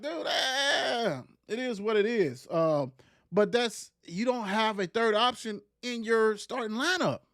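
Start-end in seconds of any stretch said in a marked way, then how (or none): none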